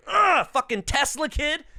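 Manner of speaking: high, fed-up groan; annoyed grumble. Retold or imitated. Mock annoyance at the show-off classmate. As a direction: roll your eyes at the kid. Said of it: "I was like".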